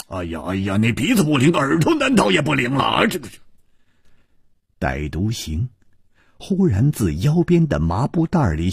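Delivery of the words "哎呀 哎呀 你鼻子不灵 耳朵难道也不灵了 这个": exasperated scolding; impatient stamping. A narrator's reading. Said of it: "蝴蝶花跺着脚说". (不耐烦地跺脚埋怨)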